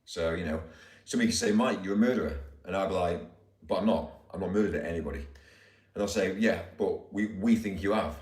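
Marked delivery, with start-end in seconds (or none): none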